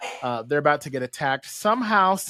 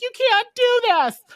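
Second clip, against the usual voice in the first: high, loud, drawn-out objection; mock protest; 6 dB louder, slower than usual. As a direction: protest in a hammed-up squawk. Voices Charles objecting to Magneto.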